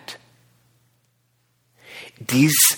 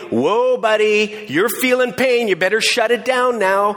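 slow, higher warning from the mind; slow, raised voice warning a buddy; cautioning inner voice; 8 semitones higher than usual. Acted. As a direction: coax yourself to ease off. Mind warns during a workout.

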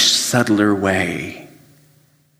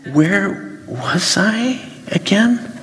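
higher, slow, puzzled; comically lost, higher and slow; bewildered; 11 semitones higher, much slower than usual. Retold or imitated. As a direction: drag it out like you've lost your place. Lost track, quoted to self.

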